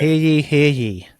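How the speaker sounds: slow mock town crier; renaissance fair olde speech. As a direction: proclaim it like a herald.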